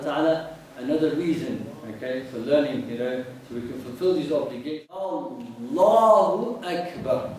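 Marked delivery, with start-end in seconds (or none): 4.9-7.4 slow demo. Shown as a demonstration. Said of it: "Is that clear?".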